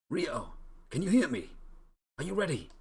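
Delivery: high, loud call across a distance. Acted. Hero calling out to Ryo, high and loud. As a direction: shout to reach a friend far away.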